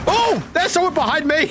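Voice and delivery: high startled exclamation. Real, not acted. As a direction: jump at a threat from behind. Finds an enemy behind.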